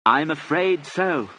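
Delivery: slow, high, spelled out; grave confirmation. A narrator's reading. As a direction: draw it out for a deaf listener. Confirming death to deaf lady.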